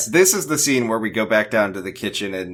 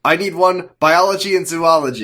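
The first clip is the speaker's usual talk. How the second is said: raised, higher acted demand; urgent mock yelling; 8 semitones higher, 5 dB louder than usual. Rose demanding a book amid chaos, urgent mock yell. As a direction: call it out over the others. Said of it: "everyone's yelling over each other".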